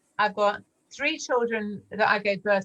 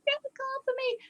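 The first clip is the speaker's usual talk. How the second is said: mock-pathetic whine, pitched way up; pathetic pleading. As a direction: beg him like a helpless little thing. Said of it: "I do this whole pathetic".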